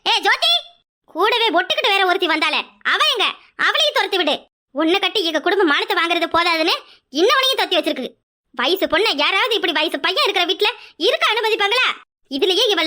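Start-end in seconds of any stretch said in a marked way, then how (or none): none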